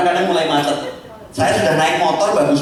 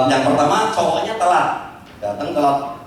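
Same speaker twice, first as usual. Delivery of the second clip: ordinary talk, nothing marked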